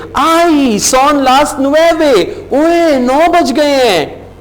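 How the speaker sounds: high, stretched oh-no surprise; hammed-up surprise. Shown as a demonstration. Teacher hams up the alarm at the late hour. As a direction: play it as a startled realisation.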